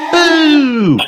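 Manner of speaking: loud, high-pitched ghost boo; shouted boo. A narrator's reading. Peppa jumping out at Granny as a ghost, high shout. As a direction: shout it to make someone jump. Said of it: "shouted Peppa".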